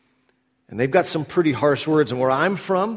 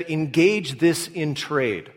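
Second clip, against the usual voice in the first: slow deliberate rewording; much slower than usual. Shown as a demonstration. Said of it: "or better said".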